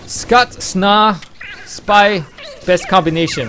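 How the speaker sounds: high, loud imitation Korean accent. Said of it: "Koreans are like".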